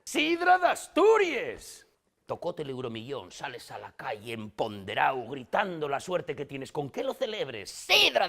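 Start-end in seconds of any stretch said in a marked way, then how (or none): 0.1-1.9 very high, loud, drawn-out cheer; over-the-top celebratory yell; slogan shout. Acted. Shouted answer, the running slogan. 7.7-8.3 loud, drawn-out slogan cheer; slow, raised-pitch jubilant yell; celebratory shout. Acted. Celebrating a lottery win, shouting.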